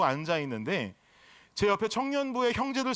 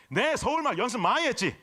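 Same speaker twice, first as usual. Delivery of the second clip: imitated Gyeongsang lilt, pitched up; Gyeongsang dialect; 4 semitones higher than usual. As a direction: put on a comic Gyeongsang accent. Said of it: "자체가 사투리 아닙니까?". (높은 억양의 경상도 사투리 흉내)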